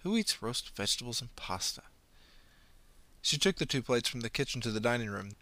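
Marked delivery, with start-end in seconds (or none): 0.0-1.8 sneering aside. Acted.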